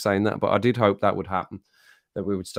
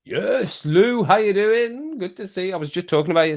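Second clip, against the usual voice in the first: excited greeting; 8 semitones higher, 3 dB louder than usual. Real, not acted. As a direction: welcome her warmly and with delight. Greeting a viewer who joined.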